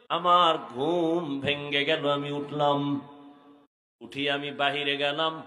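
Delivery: slow chant with drawn-out line endings; melodic sermon narration. Acted. Preacher tells of finding Abu Bakr at prayer, melodic chant. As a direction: intone the story on held notes.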